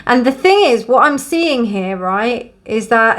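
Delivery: normal talking voice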